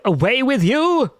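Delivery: hammed-up royal banishment; theatrical banishing. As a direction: banish them like a stage king. Dramatic dismissal.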